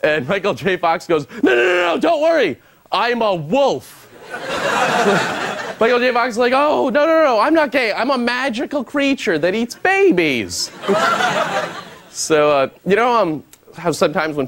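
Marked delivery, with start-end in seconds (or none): 1.4-4.0 high shouted no, no, no, easing off; a hammed-up frantic denial; shouting high, then calming down; mock reassuring shout. Michael J. Fox line acted. 6.5-10.7 high, earnest protest that slows down; mock earnest plea, pitched high; hammed-up denial. Michael J. Fox line acted again.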